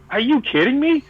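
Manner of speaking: high-pitched retold astonishment; amazed disbelief. Fan spotting Gene's costume backstage, amazed and high. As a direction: lift it high in starstruck disbelief. Amazed at seeing the band's gear.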